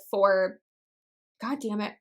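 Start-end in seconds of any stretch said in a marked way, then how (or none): none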